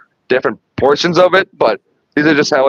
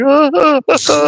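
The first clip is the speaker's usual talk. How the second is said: high, frightened little gasps; mock scared stammer; 10 semitones higher than usual. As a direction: imitate the scared janitor. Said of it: "Like, so scared".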